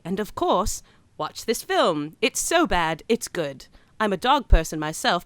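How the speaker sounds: put-on, amateurish British accent; bad London accent. Podcast host reading a London listener's letter, bad London accent. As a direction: read it in a poor imitation of London English. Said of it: "talk in a bad accent".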